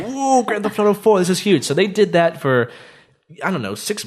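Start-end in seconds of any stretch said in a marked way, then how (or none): 0.0-1.6 higher and louder hyped-up awe; excited. Retold or imitated. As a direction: gush like a fan seeing the trailer. Retelling hype over the trailer.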